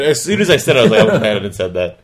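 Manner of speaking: real laughter breaking through the words; laughing. Real, not acted. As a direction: talk through a laugh at your own slip. Cracking up at the comeback.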